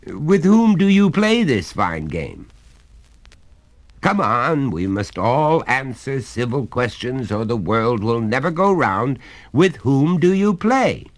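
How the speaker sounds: a hearty father coaxing his son; genial father. Acted. Father presses the question.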